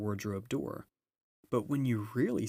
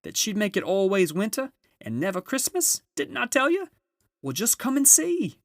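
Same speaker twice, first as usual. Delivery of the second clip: high, loud joyful calling; a beaver shouting the good news; excited shout; 12 semitones higher, 8 dB louder than usual. A narrator's reading. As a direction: call it out, much higher and louder. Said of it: "answered Mister Beaver".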